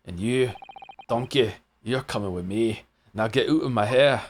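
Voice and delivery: lightly voiced Scottish governor; amateur Scottish accent; attempted Scottish accent. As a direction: try a gruff dismissal in a Scottish accent. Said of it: "Super Scottish, very super Scottish".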